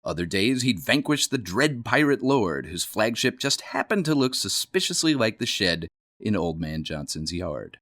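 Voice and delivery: ordinary delivery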